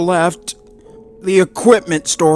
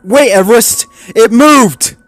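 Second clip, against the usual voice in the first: much higher, louder urgent warning; alarmed call; 12 semitones higher, 9 dB louder than usual. Acted. Alarm over radio.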